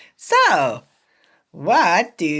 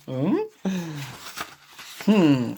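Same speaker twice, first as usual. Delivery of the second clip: ordinary delivery, nothing marked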